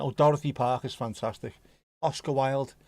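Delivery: ordinary talk, nothing marked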